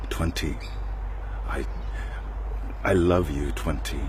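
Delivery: low, soft murmured words; hushed pretend whisper; demo whisper. Shown as a demonstration. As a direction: murmur it like into an ear. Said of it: "that's what I'm whispering".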